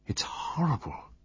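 hushed, unvoiced horror; whisper. A narrator's reading. Pamela confiding in Poirot after the death, whispering. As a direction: breathe it out in a whisper.

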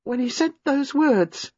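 high, slow, dread-filled voice; slow down as the memory grips; horrified remembering; haunted. A narrator's reading. Reliving the horror.

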